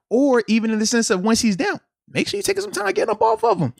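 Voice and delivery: ordinary talk, nothing marked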